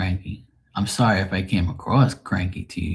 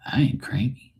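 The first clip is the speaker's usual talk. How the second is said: whisper; 4 semitones higher than usual. Real, not acted. Denying being cranky.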